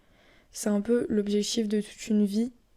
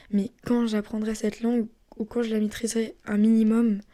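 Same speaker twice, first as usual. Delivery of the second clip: ordinary talk, nothing marked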